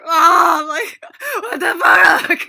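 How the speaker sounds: loud, high hammed-up wail; high-pitched fake sobbing with laughter; mock crying. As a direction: imitate bawling on the phone. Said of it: "really, like, cry".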